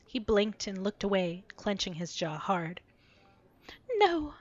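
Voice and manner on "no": breathy. Acted. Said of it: "she breathed".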